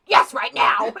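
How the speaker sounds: loud, hammed-up insistence; mock urgent shout. Man running off to confession, hammed-up urgent shout. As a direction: yell it like you're dashing off.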